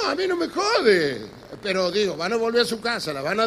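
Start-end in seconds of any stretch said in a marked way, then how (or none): 0.1-1.3 mock protest. Real, not acted. Protests mid rant.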